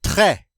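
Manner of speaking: TRÈS ending in an open eh; silent final S. Shown as a demonstration. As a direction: leave the S off "très". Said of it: "We do not pronounce it".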